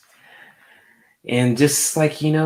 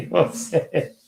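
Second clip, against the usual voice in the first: laughing; 9 semitones higher, 7 dB louder than usual. Real, not acted. Laughs while praising comment.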